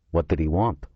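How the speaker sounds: usual speaking voice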